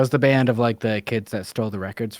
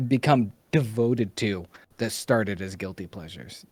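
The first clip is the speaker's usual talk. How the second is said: normal talking voice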